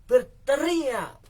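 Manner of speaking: loud, slow, drawn-out shout; stretched yell. Shown as a demonstration. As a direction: belt the word out like a cry for help. Preacher demonstrates shouting.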